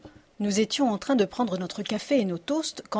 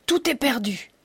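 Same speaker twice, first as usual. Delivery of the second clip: une pointe de colère désespérée; grondement contenu; 3 semitones higher than usual. A narrator's reading. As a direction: gronde un peu, comme un roi dépité. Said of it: "gronda le roi". (roi qui gronde, avec retenue)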